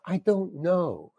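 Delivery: slow, quiet flat shrug; deadpan. Retold or imitated. Actor to the director, slow and quiet deflated. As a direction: drag it out, helpless and fed up. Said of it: "And I just said it like that".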